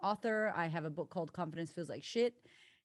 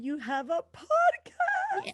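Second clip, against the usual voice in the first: squealing, much higher and louder, drawn out slowly; excited squeal; 9 dB louder, much slower than usual. Real, not acted. Guest squealing with joy that her friend has a podcast, excited. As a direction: shriek it in delight.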